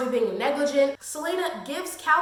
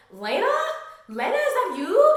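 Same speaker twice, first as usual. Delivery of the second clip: mock delighted greeting; 9 semitones higher, 5 dB louder than usual. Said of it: "And then Archer's like".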